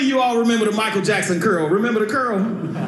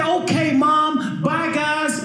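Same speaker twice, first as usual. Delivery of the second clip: put-on obedient boy; 7 semitones higher, slower than usual. Boy answers mother.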